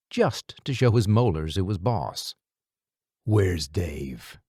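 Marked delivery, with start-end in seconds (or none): 3.2-4.4 low, deep gruff voice; curt deep demand. A narrator's reading. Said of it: "the guy said gruffly".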